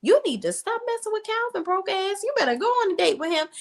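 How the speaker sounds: high, squeaky mock Maurice voice. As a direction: play him as a shrill, bossy friend. Maurice voiced, scolding friend.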